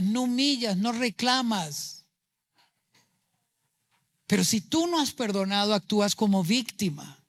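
usual speaking voice

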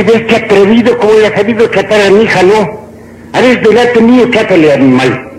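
high-pitched indignant mother-in-law; acted scolding. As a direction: tell off the son-in-law, mock outrage. Mother-in-law scolds son-in-law in joke. (regaño fingido de suegra, tono agudo)

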